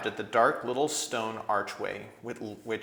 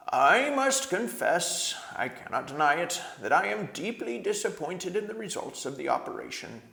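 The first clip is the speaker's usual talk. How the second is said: put-on rueful old doctor; 9 semitones higher, 5 dB louder than usual. A narrator's reading. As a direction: confess it with dignity. Voices Doctor Cornelius.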